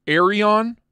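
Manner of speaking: "Arion" as AIR-ee-on, first vowel like AIR; trial pronunciation. Shown as a demonstration. Streamer runs through ways to say it, "Arion" as AIR-ee-on. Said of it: "There's no other way to say it".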